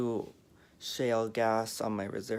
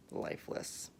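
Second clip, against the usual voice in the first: whispered; 7 dB quieter than usual. Real, not acted. Mother in open casket.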